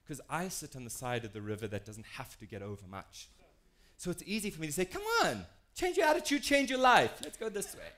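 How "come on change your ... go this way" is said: high-pitched, mock pep-talk urging; glib exhortation. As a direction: breezily push them along, voice pitched way up.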